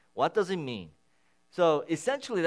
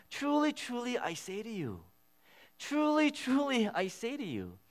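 "Truly, truly" pitched much higher and punched; emphatic repetition; 10 semitones higher than usual. Shown as a demonstration. Pastor showing how Jesus repeats the phrase, emphatic high "Truly, truly". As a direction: ring out each "Truly" high and strong. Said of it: "He repeats that three times".

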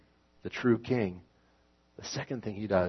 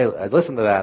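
usual speaking voice

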